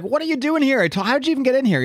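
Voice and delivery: high, sharp disbelieving shout; startled outburst. Retold or imitated. Captain America catching the reporter behind him, startled. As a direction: snap at him like he shouldn't be here. Cap confronts the sneaky reporter.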